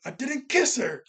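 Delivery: mock an indignant yell in retelling. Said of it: "we just hear Oric yelling".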